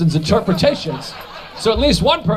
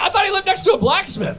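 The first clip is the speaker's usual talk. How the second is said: exasperated; 11 semitones higher than usual. Real, not acted. Mock outrage at Picasso dates.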